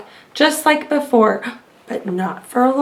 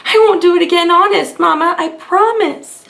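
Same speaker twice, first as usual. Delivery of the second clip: pleading giant; 4 semitones higher than usual. A narrator's reading. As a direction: beg like a caught kid. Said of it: "whimpered the giant".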